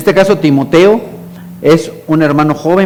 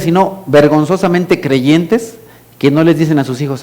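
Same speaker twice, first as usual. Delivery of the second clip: usual speaking voice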